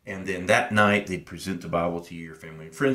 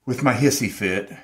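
ordinary talk, nothing marked

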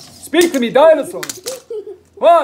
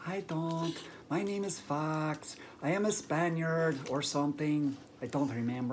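much lower, much softer puppet fox; 9 semitones lower, 17 dB quieter than usual. Acted. Fox answers Bear about his accent, low and soft. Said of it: "Why do you speak with same accent as me?".

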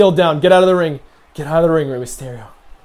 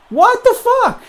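very high, shrieking disbelief; outraged disbelief; 4 dB louder, slower than usual. Real, not acted. Player outraged at the opponent's finisher, shrieking. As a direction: screech it in shock.

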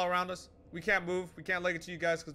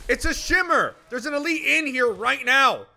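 loud, high urgent warning; alarmed shouting; 6 semitones higher, 9 dB louder than usual. Real, not acted. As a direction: shout it out to the squad. Warning squad of invisible elite.

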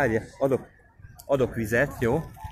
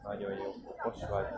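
soft praise; 3 semitones lower, 14 dB quieter than usual. Real, not acted. Father gently guiding his little son, soft and tender.

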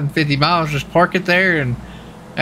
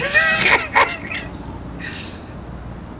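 shrieking laugh; 5 dB quieter than usual. Real, not acted. Sees partner's flaming boots.

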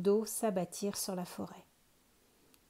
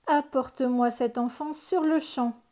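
loud, higher commanding ogre; ogre demand; 4 semitones higher, 8 dB louder than usual. A narrator's reading. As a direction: bark the order at the witch. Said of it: "gronda l'ogre".

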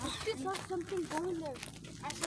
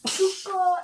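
calling into the dark; 5 semitones higher, 13 dB louder than usual. Real, not acted. Calling out to a friend.

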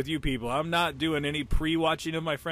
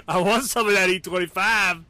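loud, higher put-on voice; voicing the tank; 4 semitones higher, 7 dB louder than usual. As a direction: imitate an eager attacker, loudly. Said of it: "It says".

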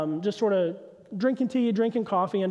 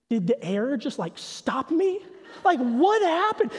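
put-on higher, slow, puzzled little-kid voice; confused toddler; 3 semitones higher, slower than usual. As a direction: voice a two-year-old asking what stopped him. Dad voices toddler's confused thought.

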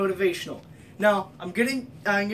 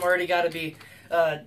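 usual speaking voice

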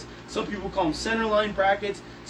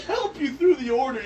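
ordinary delivery

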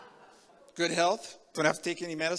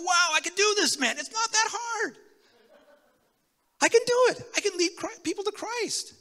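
much higher, loud, triumphant exclaiming; thrilled; 8 dB louder than usual. Retold or imitated. New witness amazed he led someone to Christ, shouting with joy. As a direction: burst out with joyful disbelief. Thrilled after first convert.

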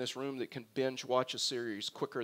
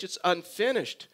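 ordinary delivery

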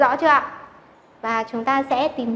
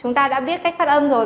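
ordinary talk

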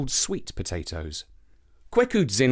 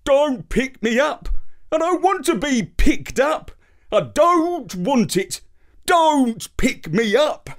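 higher, slow whiny complaint; whiny yam; 12 semitones higher, 4 dB louder, slower than usual. Acted. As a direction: whine like a stubborn yam. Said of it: "called out in a whiny voice".

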